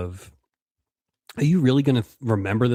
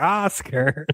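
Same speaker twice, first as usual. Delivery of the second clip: higher-pitched mock children's TV call; Sesame Street line; 6 semitones higher than usual. Podcaster mimicking a Sesame Street line, mock children's TV call. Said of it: "just like a Sesame Street line".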